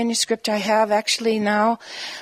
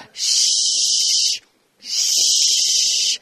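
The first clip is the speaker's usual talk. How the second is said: imitated espresso machine hiss. Poet recalling readings in espresso bars, imitated machine hiss. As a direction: imitate the steam wand with drawn-out hushing.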